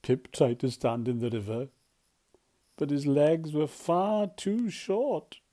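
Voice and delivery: plain reading voice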